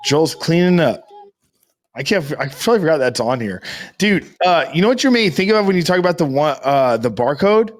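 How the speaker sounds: ordinary talk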